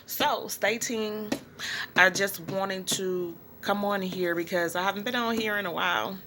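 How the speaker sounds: ordinary talk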